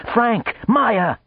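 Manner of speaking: high, loud frantic calls; agitated calling. Acted. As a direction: shout their names in a panic. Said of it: "never seen the little man so agitated".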